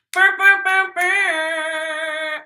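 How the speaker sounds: high, loud burring noise; celebratory burr-burr call; imitated horn blast. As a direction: trill out a hype horn, last one drawn out. Imitated noise before sign-off.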